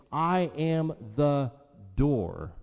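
slow, loud "I am the... door", pause before DOOR; weighty pause before "door"; emphatic declaration. Retold or imitated. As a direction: proclaim I AM high, then drop onto DOOR.

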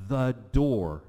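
DOOR hit loud and long; emphatic. Retold or imitated. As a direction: land hard on "the door".

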